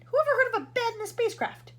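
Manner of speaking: high, loud scornful outburst; loud incredulous scolding; barking. A narrator's reading. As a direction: scoff loudly. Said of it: "barked the President".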